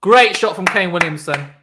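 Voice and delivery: loud, high burst of praise; excited cheer. Real, not acted. Streamer cheering Williamson's six, loud excited call. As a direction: cheer the shot like a fan.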